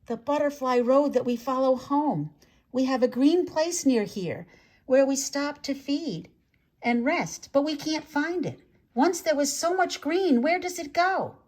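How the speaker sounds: a lost little swarm asking for help; lightly voiced butterfly chorus. A narrator's reading. Butterflies speak in his mind.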